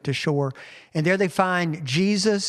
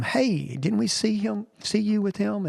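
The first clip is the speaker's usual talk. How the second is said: higher, pointed question of an accuser; lightly voiced accuser pressing Peter; accusing bystander; 6 semitones higher than usual. Bystanders at the fire question Peter, higher and pointed.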